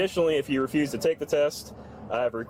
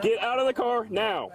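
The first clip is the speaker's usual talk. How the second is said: higher, slow firm order; stern command; 8 semitones higher than usual. Real, not acted. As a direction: bark the order, slowly and higher. Officer repeats order, escalates.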